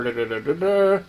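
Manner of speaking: sung-out "da-da-da" rising at the end; playful vocal fanfare climbing to a reveal; mock drumroll. Vlogger unveiling the paint texture to viewers, mock drumroll.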